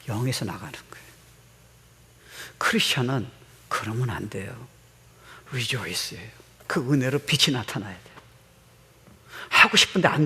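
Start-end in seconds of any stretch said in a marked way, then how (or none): 0.1-6.3 a soft unvoiced whisper; hushed preacher's whisper to the congregation. Real, not acted. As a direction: lean in and breathe the words.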